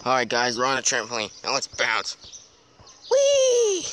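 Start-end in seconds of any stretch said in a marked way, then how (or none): 3.1-3.9 high excited whee; gleeful squeal. Pretend. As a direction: squeal with joy mid-bounce. Bouncing on trampoline.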